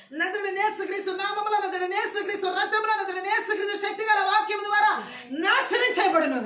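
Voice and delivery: high, loud chanting-like proclamation; full-force preacher invoking in prayer; fervent prayer declaration. Real, not acted. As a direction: proclaim it with full spiritual authority.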